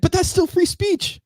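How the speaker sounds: high, slow indignant mock girl voice; imitated German girl. Girl objecting to Crowder's point, high imitated voice. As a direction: pitch it way up, mock-protesting. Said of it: "And she's like".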